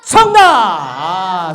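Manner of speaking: shout. Real, not acted. Host shouting correct answer.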